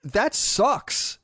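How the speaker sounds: high, drawn-out exasperated no. Retold or imitated. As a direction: groan it out, slowly. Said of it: "And I'm like".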